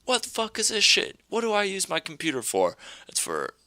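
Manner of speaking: high-pitched mock shriek of outrage; outraged mom. Mom finding porn on her computer, high mock outrage. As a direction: ham up a furious church lady. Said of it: "She's like".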